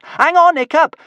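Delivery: very high, loud call across the ice; shout. A narrator's reading. Kamikaze at the wheel shouts to Hiccup, loud and high. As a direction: yell it to him from the wheel. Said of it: "shouted Kamikaze".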